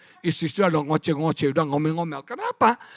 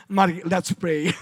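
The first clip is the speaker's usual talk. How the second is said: slow, halting mock prayer opener; a hint of a stumbling English preacher; slower than usual. Preacher mocking his own halting English prayer invitation, slowly. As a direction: mimic a nervous English sermon start. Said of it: "melongos lah".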